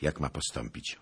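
ordinary delivery

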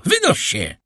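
high, sharp order to get out; sharp shout. A narrator's reading. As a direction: cry it out sternly. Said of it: "krzyknął pan de Villefort tak ostro". (ostry, wysoki okrzyk)

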